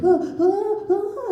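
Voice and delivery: high mock-alarmed "ah"s; alarmed onlooker cries. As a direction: series of high, startled cries. Acts guests reacting to wobbling coffin.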